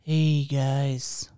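low, laid-back imitation; cool guy voice. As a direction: drop it down to a cool-guy register. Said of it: "This is your cool guy voice".

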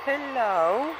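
high, drawn-out hello, swooping up at the end; high singsong greeting to a pet; cooing greeting. Real, not acted. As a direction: coo hello to a little animal, pitched high. Greeting the turtle they found.

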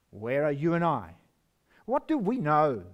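ordinary talk, nothing marked

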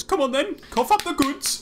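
high, squeaky demand; demanding creature. Acted. Owen demanding the food from Grimald, high and squeaky. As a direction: squeak it like a little mugger. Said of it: "he looks at you and goes".

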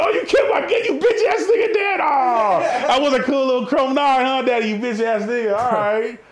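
very high-pitched, hammed-up outrage; mock outraged wailing. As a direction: wail it loudly like a comic tantrum. Acted outburst at dad over gun.

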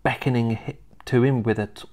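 ordinary delivery

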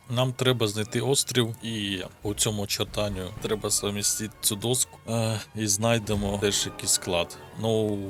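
ordinary talk, nothing marked

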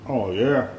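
ordinary talk, nothing marked

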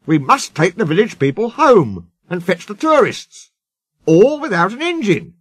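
high, exasperated fretting; vexed boss. Acted. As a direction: complain with rising agitation. Controller frets over stranded passengers.

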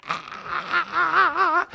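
high strained wail of the flesh; mock wounded cry, pitched high; flesh whining. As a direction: whine like a kid in a tantrum. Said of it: "'cause it's like".

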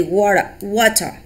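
"water" ending in a plain open "uh" with no R; British pronunciation. Shown as a demonstration. Teacher to pupils, British "water" with no R. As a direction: say "water" as British watah, dropping the final R. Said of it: "In British, we say".

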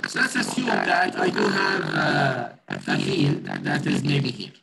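glitchy mic, pitch warping; fluctuating voice. Real, not acted. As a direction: voice breaking up over a bad line. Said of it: "Sir, your voice is fluctuating".

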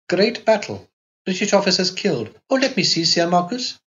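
put-on a girl snatching at the news; voiced young girl. A narrator's reading. Girl reads the war headlines.

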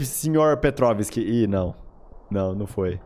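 normal talking voice

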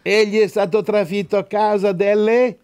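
high, ringing Bible recitation; forceful preaching. Real, not acted. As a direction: proclaim the verse to the congregation.

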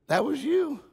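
very high, comic mock surprise; surprised realization. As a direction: squeak it out in feigned astonishment. God surprised in joke's punchline.

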